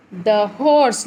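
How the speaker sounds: "horse" with a long drawn vowel; long sound. Shown as a demonstration. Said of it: "long sound".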